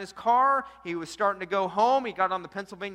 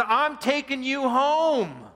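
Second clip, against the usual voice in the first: firm slow declaration; 7 semitones higher, 5 dB louder, much slower than usual. Retold or imitated. Tony confronts the runaway husband.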